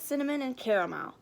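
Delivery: ordinary delivery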